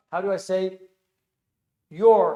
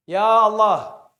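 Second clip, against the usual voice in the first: normal talking voice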